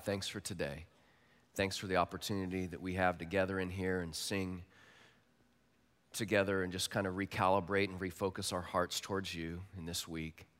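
soft, low prayer voice. Real, not acted. Pastor praying over his congregation, soft and low. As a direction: pray gently and low.

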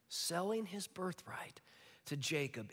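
hushed, slow whisper of a grave loss; whispered repeat. Shown as a demonstration. Preacher whispers Esau's loss to the congregation, slow and hushed. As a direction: breathe it out slowly, barely voiced.

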